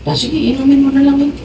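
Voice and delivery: high, quick mock reply; teasing wife. Wife teasing her husband to drink the oil. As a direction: toss the line off lightly. Wife's retort in retold dialogue.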